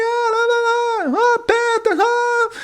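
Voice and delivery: very high, far-off mock shouting of the family; mock loud in-laws. Pastor mimicking his loud in-laws calling out to each other. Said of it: "eles falam muito alto".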